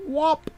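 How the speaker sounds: high popping sound effect; a sudden high whop. Acted. Sound effect after punchline.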